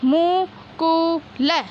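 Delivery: मुकुल stretched syllable by syllable, मु-कु-ल; very slow sounding out. Shown as a demonstration. Teacher to pupils, stretching the word very slowly. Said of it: "इस प्रकार पढ़ना है".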